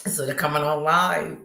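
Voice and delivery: normal talking voice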